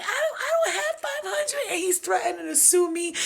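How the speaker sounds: very high squealing wail through laughter; cry-laugh her panic; mocking friend, laughing. Mocking the friend who owes money, high laughing wail. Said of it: "'Cause she was like".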